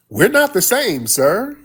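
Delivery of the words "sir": ordinary delivery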